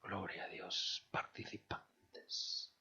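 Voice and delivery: soft reverent whisper, almost silent; hushed whisper. Real, not acted. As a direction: breathe the words out in a whisper, slow. Preacher drops to worshipful hush.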